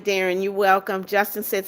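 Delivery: ordinary delivery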